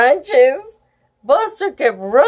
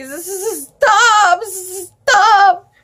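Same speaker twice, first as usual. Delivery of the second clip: quivering stammer; 7 semitones higher, slower than usual. Acted. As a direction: stammer in fear. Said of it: "my words come out quivering".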